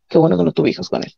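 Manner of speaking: quick relieved whisper; whispered aside. Real, not acted. Telling the interviewer she's glad she had no children with him, whispered. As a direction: breathe it out to yourself.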